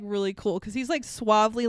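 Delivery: usual speaking voice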